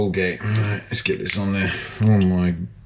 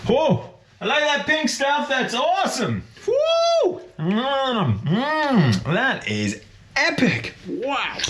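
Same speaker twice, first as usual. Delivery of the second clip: high excited gushing; thrilled by the find; delighted; 14 semitones higher than usual. Real, not acted. Delighted at pink toothpaste.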